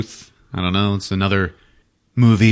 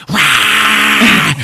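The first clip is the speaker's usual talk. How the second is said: much higher, louder monster yell; merman threat cry; 10 semitones higher, 8 dB louder than usual. Retold or imitated. Merman scares the hissing cat, loud monster cry. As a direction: puff up and bark at the cat. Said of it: "he's like".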